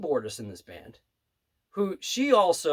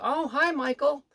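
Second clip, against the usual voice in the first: put-on high, slow, gentle old-lady greeting; sweet old lady; 11 semitones higher than usual. Old pianist greeting the waiter in a high sweet voice. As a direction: lift the voice like a kindly old pianist. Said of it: "she was just such a sweet old lady".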